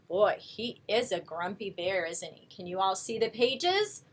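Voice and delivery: ordinary delivery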